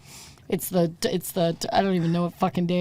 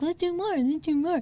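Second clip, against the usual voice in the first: put-on drunk excitement; 10 semitones higher, 4 dB quieter than usual. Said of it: "so we're like".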